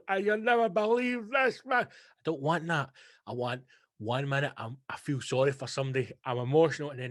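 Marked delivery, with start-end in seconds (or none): 0.1-1.8 mock sobbing. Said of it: "crying like a wee lassie sobbing".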